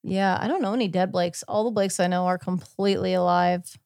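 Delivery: ordinary talk, nothing marked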